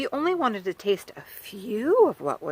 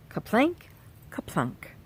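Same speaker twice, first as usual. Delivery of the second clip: sound effect words; 2 semitones higher, slower than usual. Acted.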